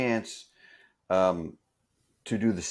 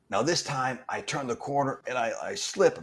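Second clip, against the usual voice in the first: usual speaking voice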